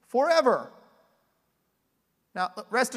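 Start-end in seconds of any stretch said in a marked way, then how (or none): 0.1-0.7 emphatic forever. Real, not acted. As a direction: land the word like a verdict. Preacher repeats word for emphasis.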